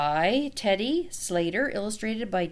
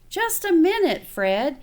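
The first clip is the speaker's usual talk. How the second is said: bright, higher brush-off; chirpy distracted mother putting him off; a busy mother's quick brush-off, voiced higher; busy mom; 7 semitones higher, 4 dB louder than usual. A narrator's reading. Mother puts Fred off.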